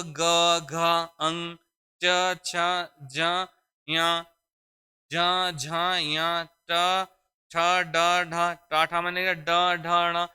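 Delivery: ग घ ज झ ड ढ ण said slowly one by one, with a slight throat vibration; voiced consonants demo. Shown as a demonstration. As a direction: draw out each voiced letter so the buzz is felt. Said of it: "हल्का सा कंपन होना है".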